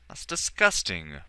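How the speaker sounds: loud, disgusted call at the door; yelled. A narrator's reading. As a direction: shout it through the door. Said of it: "Morlun yelled at the door".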